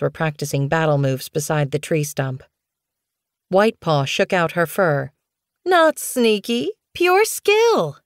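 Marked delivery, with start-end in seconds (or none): 5.6-8.0 high, slow, drawn-out comeback; cross young cat. A narrator's reading. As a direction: stretch each word in a high, cross voice. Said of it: "stared at her denmate crossly".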